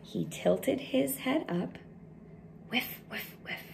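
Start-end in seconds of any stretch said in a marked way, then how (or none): 2.7-3.6 quick sniffs of the air, sounds only; soft sniffing noises. Acted. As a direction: sniff out the breeze like a bear.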